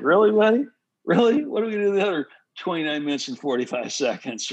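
sarcastic "Really, buddy?" then a fast dig; sarcastic coach. Retold or imitated. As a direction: scoff at him like a fed-up coach. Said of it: "the coach is like".

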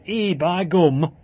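slow mock Yorkshire "Ee, by gum"; drawn-out broad Yorkshire exclamation; Yorkshire accent.

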